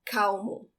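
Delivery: Calmo with the L said like a W, kaumo; Calmo, unrolled final L, like now; Portuguese L as W. Shown as a demonstration. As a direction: say Calmo so the L sounds like cow. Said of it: "it sounds like the W in English".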